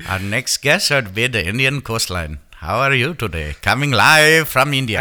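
mock Indian accent. Announcing a guest live from India, mock Indian accent. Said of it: "I guess I asked for that accent".

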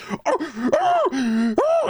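high whimpering oh-oh-oh; dog whining. Retold or imitated. Owner imitates the dog whining alone, high whimper. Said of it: "And he's".

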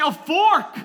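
put-on high, loud kid's retort; picky child. Daughter demanding a fork, picky child. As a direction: shout back like an insistent child. Dad imitating picky six-year-old.

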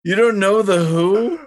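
loud, high, drawn-out disbelief; incredulous outburst. Real, not acted. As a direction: cry out in stunned disbelief at a friend. Shocked friend has never heard band.

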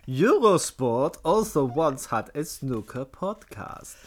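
mock annoyed German reading; annoyed German accent. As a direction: read it like an irritated German. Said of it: "Can you read out as a German? An annoyed German".

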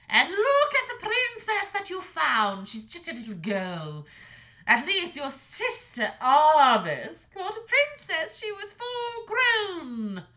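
high, loud scornful mother; scornful dragon mother. A narrator's reading. Mother dragon sneering at her son's princess, high and loud. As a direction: belittle his choice with contempt.

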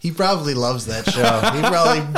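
loud, laughing words. Real, not acted. Cracking up picturing Jordan Peterson loving the show, laughing. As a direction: crack up while you say it.

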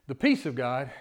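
ordinary talk, nothing marked

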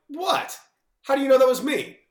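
high, loud, caught-out squeak of disbelief; playing innocent but clearly caught; mock surprise. To teammate over the decorated locker, a high surprised yelp.

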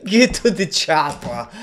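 put-on high, slow Austrian accent through laughter; mock Austrian accent. Actor echoing the film line, laughing Austrian accent.

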